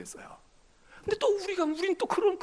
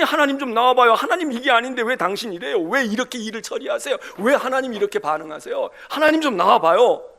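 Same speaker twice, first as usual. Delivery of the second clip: loud, indignant challenge to God; defiant demand; 3 semitones higher, 11 dB louder than usual. Acted. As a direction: call God out to face you. Job confronting God, acted. (하나님께 따지듯 크게 외치기)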